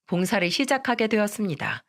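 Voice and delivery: plain reading voice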